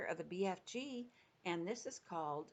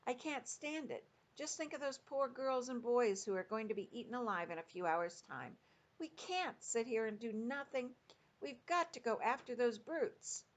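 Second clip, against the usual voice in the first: higher, urgent outcry; cried out; 6 semitones higher than usual. A narrator's reading. Sophie cries out to the giant to go after the brutes, high and urgent. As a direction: burst out in helpless distress. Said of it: "she stood up and cried out".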